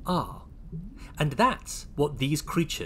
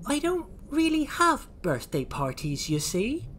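high innocent character voice, dropping at the end; Oisin; 13 semitones higher than usual. Acted. Oisin confides in the naughty Lloyds, pitched high. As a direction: explain it in a high little voice. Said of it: "said Oisin".

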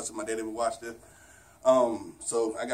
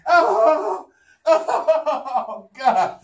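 breathless laughing relief; exhausted laughing; 12 semitones higher, 10 dB louder, much slower than usual. Real, not acted. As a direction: laugh it out after the effort. Laughing relief after last rep.

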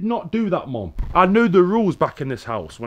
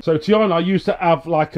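ordinary talk, nothing marked

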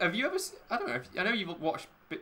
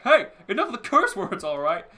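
much higher and louder, a tough American guy; big American man; 7 semitones higher, 11 dB louder than usual. Retold or imitated. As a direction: bark it like a big bouncer. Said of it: "And he's like".

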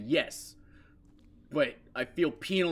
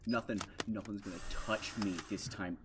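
ordinary talk, nothing marked

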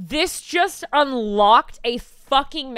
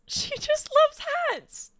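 doting coo; slower than usual. Real, not acted. Cooing about the hat designer.

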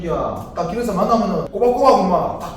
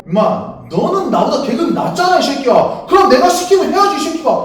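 angry yelling; 5 semitones higher, 5 dB louder than usual. Acted. Sergeant berating subordinate.

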